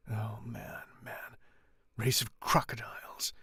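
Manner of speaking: whispered contempt; bitter whisper. Acted. The Count condemning the crowd in a scornful whisper. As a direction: hiss it out in disgust. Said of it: "cried the Count".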